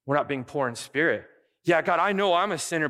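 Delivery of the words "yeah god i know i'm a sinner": insistent, higher, louder protest to God; pleading that they deserve punishment; self-righteous pleading. Acted. Preacher voicing a believer arguing with God, raised insistent pitch.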